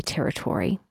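"territory" as terri-TOR-ee; "territory" ending like "Tory"; newbie pronunciation. Shown as a demonstration. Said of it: "the mark of a newbie".

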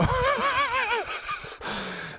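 high, breathy fit of laughter; laughing. Real, not acted. Host still cracking up after the caller's song, high laugh.

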